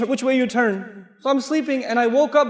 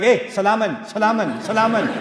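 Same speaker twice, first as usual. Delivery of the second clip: mock salaman; 3 dB louder than usual. Shown as a demonstration. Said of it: "Not like that".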